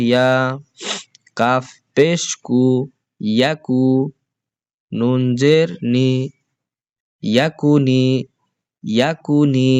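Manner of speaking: teacher's chanted letter-by-letter recitation; spelling drill. Shown as a demonstration. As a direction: spell out each syllable for the class to repeat.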